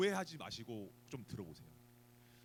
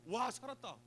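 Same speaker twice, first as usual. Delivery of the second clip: higher, thrilled sigh of relief; drowning man seeing rescue, mildly thrilled; moved relief; 7 semitones higher, slower than usual. Retold or imitated. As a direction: marvel at being saved. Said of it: "막 감격스러울 거 아니에요".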